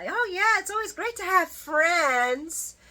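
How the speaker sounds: Blaze brushing it off. Retold or imitated. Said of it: "Blaze said something about".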